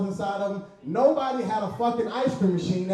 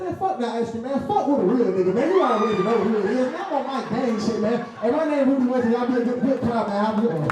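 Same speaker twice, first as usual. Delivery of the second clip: louder, hyped street swagger; 6 semitones higher, 4 dB louder than usual. Retold or imitated. Customer posing for the worker's phone, loud swagger. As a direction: switch to posturing the moment a camera appears. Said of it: "I said".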